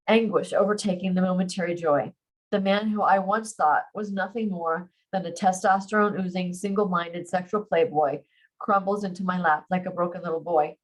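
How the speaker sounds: ordinary delivery, nothing marked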